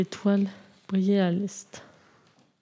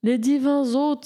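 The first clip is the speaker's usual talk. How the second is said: exclaiming; 6 semitones higher than usual. A narrator's reading. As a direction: call it out in wonder. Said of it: "s'écria le vieux mouton".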